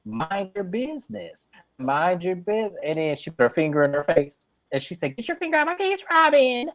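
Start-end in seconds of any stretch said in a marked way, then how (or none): 0.1-2.8 higher-pitched imitation of telling someone off; imitated bossy rebuke; mock scolding. Acts out Robin scolding Ashley. 5.2-6.7 high, pitched-up quarrel imitation; hammed-up confrontation voice; mock indignant. Acts out a face-to-face quarrel.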